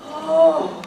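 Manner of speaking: big audible breath out; deep calming breath. Shown as a demonstration. Teacher leading children to breathe out anger, deep sigh. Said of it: "breathe with me to help me get rid of my angers".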